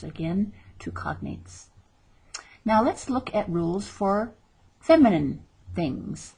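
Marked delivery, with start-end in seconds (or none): 0.0-1.7 whispered aside. Real, not acted. Teacher aside.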